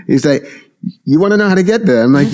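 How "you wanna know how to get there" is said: high, fast Brooklyn New York Italian voice; Brooklyn Italian guy. Retold or imitated. Brooklyn Italian truck guy giving a stranger directions, Brooklyn accent. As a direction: do the helpful Brooklyn Italian workman. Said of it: "very Brooklyn, New York, Italian guy".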